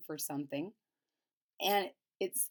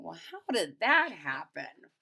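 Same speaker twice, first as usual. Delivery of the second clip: puzzled grandma; 4 semitones lower than usual. Retold or imitated. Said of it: "Grandma's thinking".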